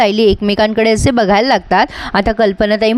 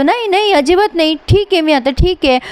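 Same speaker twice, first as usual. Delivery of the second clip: flustered denial; 7 semitones higher than usual. Acted. Flustered denial after a nightmare.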